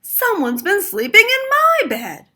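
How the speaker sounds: hollered. A narrator's reading. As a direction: holler it across the house. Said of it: "Mister Chan hollered".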